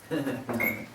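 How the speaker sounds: short soft laugh at his own joke; quick chuckle. Real, not acted. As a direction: let out a little laugh.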